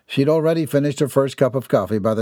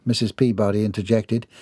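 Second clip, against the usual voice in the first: ordinary delivery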